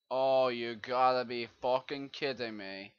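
ordinary delivery

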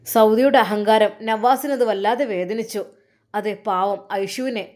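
ordinary delivery, nothing marked

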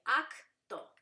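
"acto" as ac, pause, to; "acto" in two separate beats; split into syllables. Shown as a demonstration. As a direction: break "acto" after a hard c, loud first beat. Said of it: "C T se divide".

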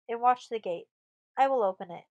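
loud, decisive command; cried order. A narrator's reading. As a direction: call it out firmly. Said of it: "she cried".

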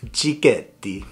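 cicchetti with a playful Italian accent. Shown as a demonstration. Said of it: "fun to say in an Italian accent".

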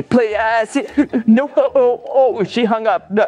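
high, broken pleading cries; heartbroken wail. Acted. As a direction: wail as the line goes dead. Begging ex who hangs up.

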